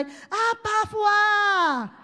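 slow, high, full-voiced shout of Abba Father; shouted prayer. Shown as a demonstration. Preacher leads the church, shouting slowly. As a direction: cry it out loud and long. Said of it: "来讲一次，来".

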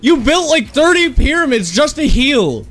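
ordinary delivery

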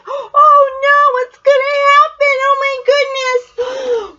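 high, loud hammed-up panic; mock alarm. Nana gasping at the chase for her listeners, high and loud. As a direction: play up the suspense for the kids. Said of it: "narrator's own mock alarm".

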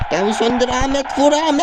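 yelling at the game to shoot; urging shout. Real, not acted. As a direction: yell at the players to shoot. Shouting orders at players.

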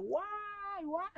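high mock wail of "guay, guay"; retold cries of pain. After falling off the mountain bike, high imitated wailing. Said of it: "yo estaba allá a los gritos".

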